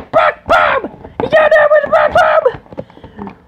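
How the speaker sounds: high yelling of the move; excited shout. Pretend. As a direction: scream the finisher name. Commentator shouts the finisher.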